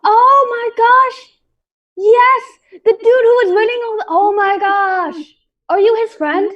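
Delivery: high, loud and gushing, faking delight at recognition; over-the-top fake joy at being recognised; fake excitement. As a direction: play it up like you're thrilled to see an old pal. Rogue feigns excited recognition.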